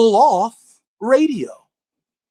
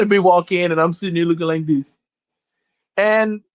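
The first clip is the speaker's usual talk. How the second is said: ordinary talk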